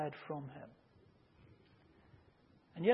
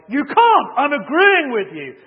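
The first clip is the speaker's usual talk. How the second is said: higher, much louder furious yell; aggressive shout; 10 semitones higher, 18 dB louder than usual. Retold or imitated. Player defies the umpire's threat, aggressive shout. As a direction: hurl it at him aggressively. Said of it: "Still really aggressively".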